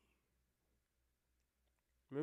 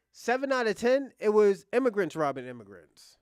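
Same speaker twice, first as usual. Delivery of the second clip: forceful, much louder burst to drive the point home; loud emphatic point; 4 semitones higher, 11 dB louder than usual. Real, not acted. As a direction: hammer the number home, loud. Heated point in a live argument.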